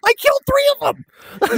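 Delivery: breathless thrilled boast; giddy disbelief. Real, not acted. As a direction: savour the unbelievable win. Thrilled after killing spree.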